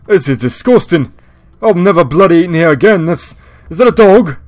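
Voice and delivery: hammed-up British rocker accent, outraged. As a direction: recoil from the food as the British rocker. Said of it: "let me know if the voice is a little too much".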